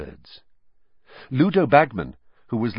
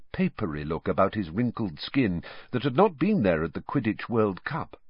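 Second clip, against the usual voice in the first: usual speaking voice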